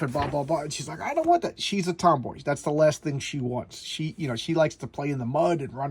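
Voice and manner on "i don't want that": put-on girl's refusal. Tomboy girl refusing the demons.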